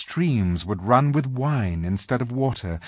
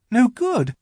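put-on high, startled little girl; rather frightened; 13 semitones higher, 6 dB louder than usual. A narrator's reading. Lucy jumping up at the faun's words, rather frightened. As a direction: jump on it in alarm. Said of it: "jumping up and feeling rather frightened".

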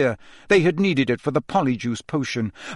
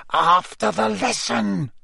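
high, drawn-out irritated refusal; snapping Snape; 8 semitones higher, slower than usual. A narrator's reading. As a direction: snap it, stretching the words. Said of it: "Snape snapped".

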